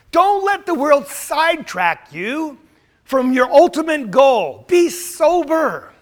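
loud, high pulpit exhortation; building to a ringing command; forceful preaching. Real, not acted. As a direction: urge the congregation with full force.

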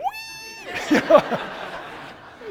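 very high, loud piggy squeal; imitated pig squeal. Traveler shows what the supposed crying baby really was, squealing. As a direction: squeal like a pig, then laugh.

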